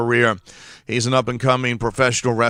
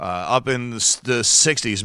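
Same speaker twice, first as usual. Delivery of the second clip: ordinary delivery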